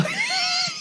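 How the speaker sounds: very high, squeaky mock cheer; high squealing whoop; high excited squeal. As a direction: shriek like an overjoyed fan. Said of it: "that's the noise he should have been making".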